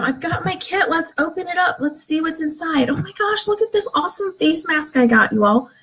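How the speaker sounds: high, gushing delight over the new kit; bubbly live-video host showing off her goodies; excited unboxing. Shown as a demonstration. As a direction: gush like you just opened the box live. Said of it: "And you're just gonna show them".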